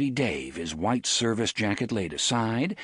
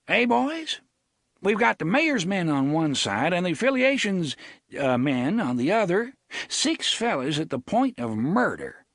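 blustering sheriff voice; 8 semitones higher than usual. Acted. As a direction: play the fussy rural sheriff. Avery voiced.

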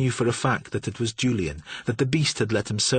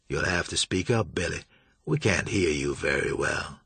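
lower, softer, mocking calm; vague amusement; 6 semitones lower, 4 dB quieter than usual. A narrator's reading. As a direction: toy with him coolly. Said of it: "the air of vague amusement".

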